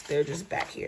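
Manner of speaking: usual speaking voice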